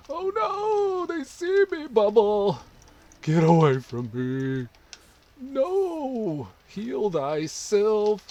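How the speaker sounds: very high, loud, panicked cries; terrified horror victim. Real, not acted. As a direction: yelp as they close in. Chased and dying in game.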